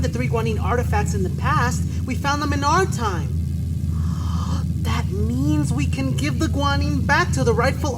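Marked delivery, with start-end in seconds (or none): 3.4-4.8 gasp. Acted. Realizing they can return artifacts.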